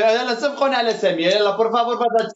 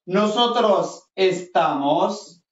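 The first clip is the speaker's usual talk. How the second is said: slow, drawn-out teacher modelling; slow rising list, like reading for the class; slow model drill; much slower than usual. Shown as a demonstration. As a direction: stretch each form out for learners to copy. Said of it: "Yasin يسمع مزيان".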